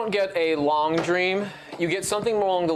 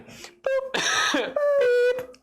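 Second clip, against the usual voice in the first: very high squeaky beeps; phone hang-up beep. Acted. GM ending the call with beeps. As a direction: mimic a phone hanging up.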